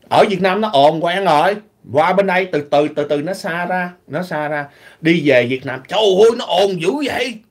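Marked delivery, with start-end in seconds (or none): none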